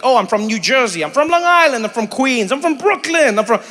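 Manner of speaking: lightly mocked chorus of locals, pitch hopping up; imitated New Yorkers. New Yorkers answering where they're from, lightly imitated. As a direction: rattle off answers like different proud locals.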